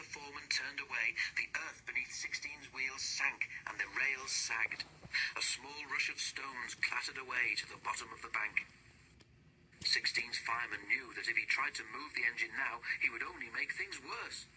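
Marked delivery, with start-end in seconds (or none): none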